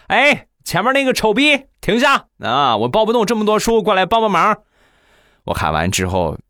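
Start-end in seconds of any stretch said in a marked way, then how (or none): 0.1-4.6 calling out while running. Retold or imitated. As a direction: yell it across the campus, arms full of books. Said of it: "一边跑一边喊".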